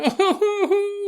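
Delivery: high wailing sob; hammed-up crying moan; a woman breaking down, mock wail; mock sobbing. Woman crying in stall, acted.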